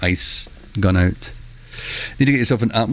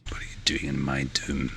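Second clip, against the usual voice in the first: whispered, much lower mock-Scottish quote; hushed repeat; 7 semitones lower, 12 dB quieter than usual. Streamer repeating the Shrek quote, in a low whisper. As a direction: drop to a low whisper, as if menacing.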